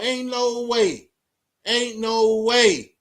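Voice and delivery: drawn-out, insistent declaration; slow emphatic drawl. Real, not acted. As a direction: stretch each word out slow and heavy. Preaching a point to viewers.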